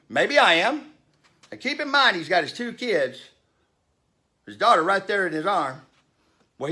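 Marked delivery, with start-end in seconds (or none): 0.1-0.9 higher, louder mock smug retort; smug passenger. Rude passenger taunting the speaker, mock smug voice. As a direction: toss it back like a snotty brat.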